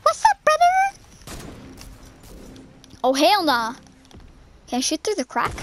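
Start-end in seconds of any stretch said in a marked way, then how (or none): 0.0-1.0 way up high, squeaky greeting. Pretend. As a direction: chirp it in a tiny high voice. Greeting in put-on voice.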